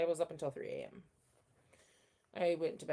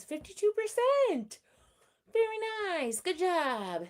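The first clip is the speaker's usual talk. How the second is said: excited praise; 12 semitones higher, 7 dB louder, slower than usual. Real, not acted. Reading viewer's chat message.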